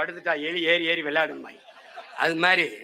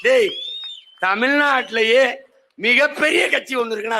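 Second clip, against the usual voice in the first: loud, higher rallying cry; loud proclamation; 4 semitones higher, 4 dB louder than usual. Real, not acted. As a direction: proclaim it to the whole crowd. Rally boast about his party. (உரத்த மேடை முழக்கம்)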